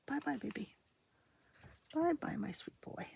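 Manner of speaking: tender goodbye. Real, not acted. Saying bye to cat.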